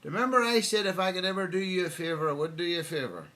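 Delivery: loud high call over the rotor noise; shouting from helicopter. Retold or imitated. Pilot shouting down from the helicopter to Will. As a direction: yell down from a hovering helicopter. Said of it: "he shouts out".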